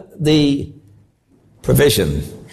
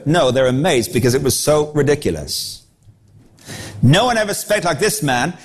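ordinary talk

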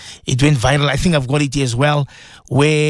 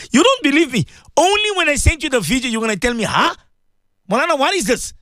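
much higher, teasing the doubters; teasing excited; 10 semitones higher than usual. Real, not acted. Host teasing a doubting listener, much higher and excited. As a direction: wait till you see it.

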